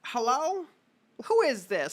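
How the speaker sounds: put-on high old lady on the phone; older woman voice. Old woman answering the phone, high and prim. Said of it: "it's the voice of an older woman".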